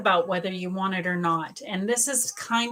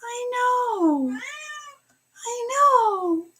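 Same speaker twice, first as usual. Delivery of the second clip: much higher cooing pet voice; much slower than usual. Real, not acted. Soothing her cat.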